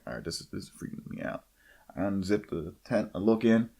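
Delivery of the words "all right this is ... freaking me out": very low, under-the-breath retelling; hushed unease. Retold or imitated. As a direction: mutter it to yourself inside a dark tent. Retells lying scared in tent.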